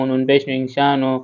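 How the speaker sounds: ordinary delivery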